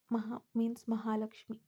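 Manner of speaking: usual speaking voice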